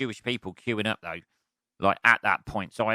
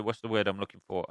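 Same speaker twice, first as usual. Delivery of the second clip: normal talking voice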